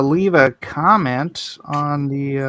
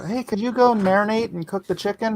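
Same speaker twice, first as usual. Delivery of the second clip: higher-pitched mimicked wife's request; imitating wife; 7 semitones higher, 3 dB quieter than usual. Wife asking husband to cook before his show, imitated higher voice. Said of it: "My wife calls me".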